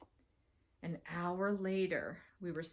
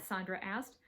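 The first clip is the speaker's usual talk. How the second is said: ordinary delivery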